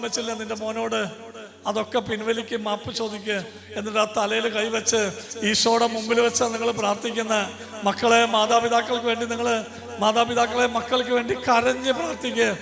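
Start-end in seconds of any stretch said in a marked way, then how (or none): 5.5-12.5 loud, high fervent preacher's cry; rising to a loud, pleading preach; loud, fervent Malayalam prayer call; preaching at full force. Acted. Preacher leading prayer, building.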